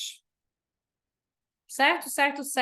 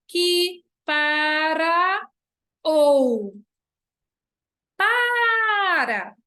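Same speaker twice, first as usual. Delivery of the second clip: slow, louder, syllables pulled apart; drawn-out syllable count; 3 semitones higher, 5 dB louder, much slower than usual. Shown as a demonstration. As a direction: stretch each syllable to show the count. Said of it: "então eu vou juntar". (sílabas esticadas e mais fortes)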